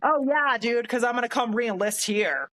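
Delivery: high mock-eager agreement; sarcastic. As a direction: pretend it is a great plan, dripping sarcasm. Sarcastic reply to the ban.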